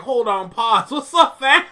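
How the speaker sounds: laughing through a mock double take; amused double take, laughing; laughing mock reaction. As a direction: pull a surprised double take while cracking up. Retelling his amused reaction.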